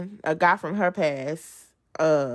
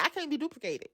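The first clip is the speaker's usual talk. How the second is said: usual speaking voice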